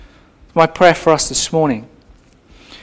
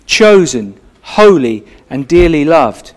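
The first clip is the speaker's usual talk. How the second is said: "Chosen", "holy", "dearly loved" each falling, with pauses between; each word set apart; 3 semitones lower, 5 dB louder, slower than usual. Shown as a demonstration. Preacher to the congregation, spacing the words with weight. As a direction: space out every word and lay weight on each. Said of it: "repeats the verse word by word".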